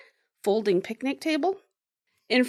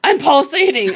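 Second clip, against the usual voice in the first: louder, insistent imitation; emphatic mock announcement; 3 semitones higher, 7 dB louder than usual. Storyteller quotes Omar as she laughs again, loud announcement. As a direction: declare it loudly as the odd healer. Retold healer's indignant announcement.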